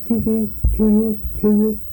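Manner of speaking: high squeaky chitty sound; squeaky shoe noise. Retold or imitated. Old man mimics his creaking leg for the interviewer, high squeak. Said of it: "cry, you know, just like a, a shoe".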